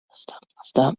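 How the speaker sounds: barely audible whispered promise. Real, not acted. Comic cuts off her movie riff, whispering. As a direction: whisper "I'll stop." to yourself.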